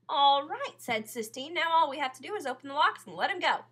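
high, loud and fast, eager; thrilled girl planning the escape; excited. A narrator's reading. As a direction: rush it out in excitement. Sistine eager to free tiger.

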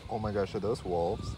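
normal talking voice

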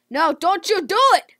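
high, loud no don't; real panicked shout; loud plea. Real, not acted. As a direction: shout it out in alarm. Pleading with another player.

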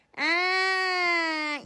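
loud, drawn-out coo; mother's loud fond coo. Real, not acted. Cooing to baby.

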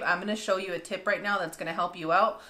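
ordinary talk

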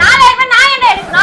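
loud, high-pitched outburst; indignant denial. Real, not acted. Accused girlfriend denies taking the money, indignantly. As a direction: throw the accusation back at full volume.

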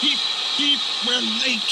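high-pitched whistle-like fretting; fussing. A narrator's reading. Edward waiting at the station, high fussing. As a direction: peep it anxiously like an engine whistle. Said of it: "fussed Edward".

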